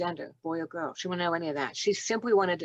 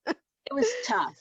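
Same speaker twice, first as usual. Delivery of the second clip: choked up; 6 semitones higher, slower than usual. Real, not acted. Recalling hard birth, voice catching.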